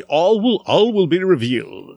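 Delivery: slow, ominous teaser voice; dramatic reveal. Pretend. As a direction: deliver it like a mysterious promise. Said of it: "That was very nice".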